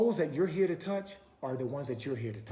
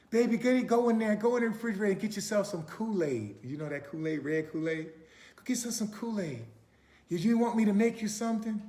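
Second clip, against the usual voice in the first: lightly voiced grandma; 7 semitones higher, 3 dB louder than usual. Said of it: "she just welcomed you".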